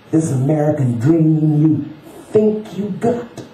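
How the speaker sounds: high-pitched, emphatic street-sage lecture; preachy challenge from a man who has seen it; insistent homeless man. Acted. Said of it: "He spoke each word as though jabbing".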